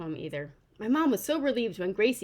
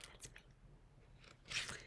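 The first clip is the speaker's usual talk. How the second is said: whispered aside; 22 dB quieter than usual. Real, not acted. Aside after naming herself author.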